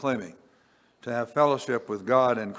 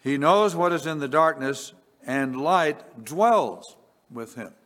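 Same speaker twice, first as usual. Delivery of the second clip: raised, measured reading of scripture; 4 semitones higher, 4 dB louder, slower than usual. Acted. Preacher reading scripture to congregation, raised and measured. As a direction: read it out as holy words.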